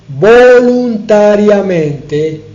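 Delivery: VOLUNTARIAMENTE stretched out slowly, syllable by syllable, and loud; emphatic stress. Real, not acted. Preacher underlining Christ's free choice, emphatic drawn-out stress on VOLUNTARIAMENTE. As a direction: lean hard and long on VOLUNTARIAMENTE. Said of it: "remarco ese voluntariamente".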